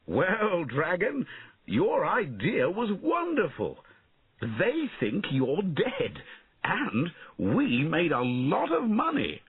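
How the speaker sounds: high, slow, jubilant call; elated high storybook knight. A narrator's reading. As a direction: call across triumphantly, stretching the words. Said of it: "he called".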